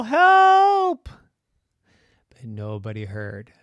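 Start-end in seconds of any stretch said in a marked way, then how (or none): 0.0-1.1 very high, loud, drawn-out yell; desperate storybook shout; cry for help. Acted. Trapped boy calls for help.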